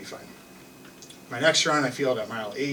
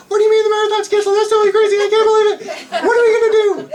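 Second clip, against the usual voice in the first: high, very loud, rapid-fire shriek; panicked outburst; 11 dB louder, much faster than usual. Retold or imitated. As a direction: blurt it out fast in hammed-up screaming panic. Retold panic at marathon cancellation.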